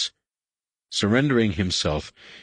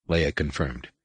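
usual speaking voice

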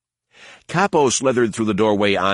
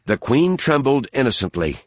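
ordinary delivery, nothing marked

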